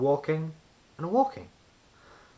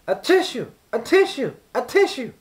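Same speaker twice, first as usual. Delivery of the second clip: high, loud sneezes shaped as "A tissue!"; three sneezes; 12 semitones higher, 10 dB louder than usual. A narrator's reading. Mister Sneeze sneezing on the wizard's cue, loud sneezes. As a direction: sneeze it three times hard. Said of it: "sneezed Mister Sneeze".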